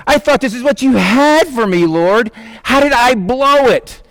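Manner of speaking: acted anguish, kicking himself; despondent pleading. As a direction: cry out to God in mock self-reproach. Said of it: "He's probably despondent".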